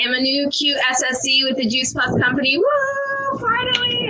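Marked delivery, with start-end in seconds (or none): 2.6-4.1 high, cheering woo and finally; excited whoop. Real, not acted. Celebrating her new rank on the team call, excited high cheer.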